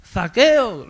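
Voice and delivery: high, loud call of the name. Acted. Jesus calling Zacchaeus over the crowd, loud. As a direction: call him down from the tree. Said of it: "no se lo diría con la voz bajita".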